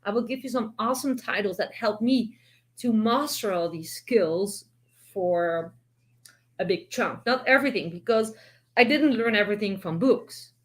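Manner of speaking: ordinary talk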